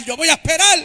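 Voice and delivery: preacher shouting over the band; shouted vow. Acted.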